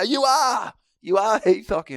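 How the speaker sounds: loud high insistence; insistent mock shout. Blokes insisting to the footballer, loud and high. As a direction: shout it like you won't take no. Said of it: "They go".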